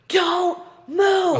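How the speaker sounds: high-pitched acted warning yell; retold scream. Strangers warning her in the pool, imitated screaming. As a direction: cry it out high, imitating panicked onlookers. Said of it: "start screaming at me".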